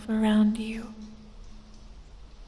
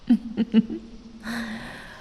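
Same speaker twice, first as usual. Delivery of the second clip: sinister chuckle. Acted. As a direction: chuckle over the prey, then breathe out. Serpent creature toys with human prey.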